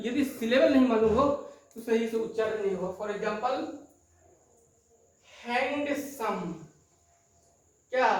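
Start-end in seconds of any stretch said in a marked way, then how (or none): 5.4-6.5 handsome drawn out slowly as two beats, हैंड-सम; syllable split. Shown as a demonstration. Teacher showing the syllables, handsome said slowly in pieces. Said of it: "the two syllable".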